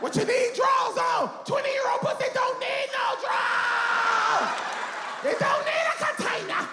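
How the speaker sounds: very high comic screech; hollered rant at the top of the voice; outraged shrieking. Acted. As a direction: shriek each line in disbelief. Outraged rant about drawers.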